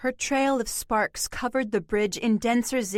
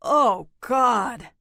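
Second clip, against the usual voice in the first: anguished cry; 4 dB louder than usual. A narrator's reading.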